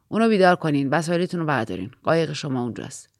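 low-voiced driver giving instructions; a driver's low, matter-of-fact orders; truck driver. A narrator's reading. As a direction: pitch it well down, as the driver. Driver gives curt orders at dock.

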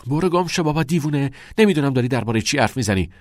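acted indignant outburst; irritated denial. A narrator's reading. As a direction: brush the accuser off angrily. Quarrel, pushes his accuser away.

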